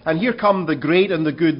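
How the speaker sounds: ordinary talk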